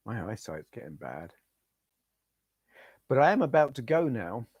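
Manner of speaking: normal talking voice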